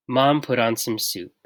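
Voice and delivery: ordinary delivery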